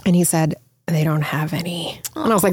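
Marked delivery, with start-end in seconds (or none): 0.9-2.0 tearful whisper. Retold or imitated. Tearful son reports iPads sold out.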